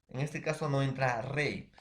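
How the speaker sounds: ordinary talk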